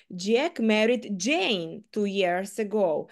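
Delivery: Jane lifted and stressed. Shown as a demonstration. Teacher shows stress moving to Jane. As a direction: put the weight on Jane. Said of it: "я кожного разу виділяла кожне слово".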